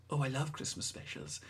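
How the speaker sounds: a light hint of a Welsh lilt, imitating; Rusty Davies's Welsh voice. Retelling a showrunner's interview to a co-host, in a light Welsh accent. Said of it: "he would say in his Welsh voice".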